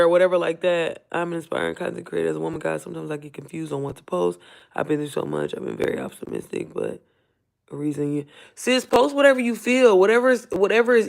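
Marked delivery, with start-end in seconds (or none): 1.1-8.3 hushed read-aloud, half to herself; reading under her breath. Real, not acted. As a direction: read a comment quietly to yourself. Reads a viewer's comment aloud.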